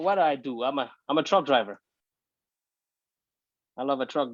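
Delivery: ordinary talk, nothing marked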